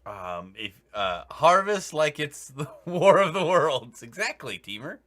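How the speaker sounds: ordinary talk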